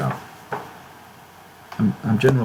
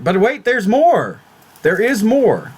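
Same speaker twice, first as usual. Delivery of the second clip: higher hyped TV pitchman; infomercial; 7 semitones higher than usual. Pretend. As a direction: sell it like a late-night ad. Infomercial catchphrase but wait more.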